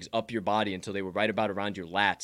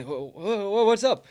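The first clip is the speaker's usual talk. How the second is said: startled; 11 semitones higher, 5 dB louder, slower than usual. Retold or imitated. Startled reply during roll.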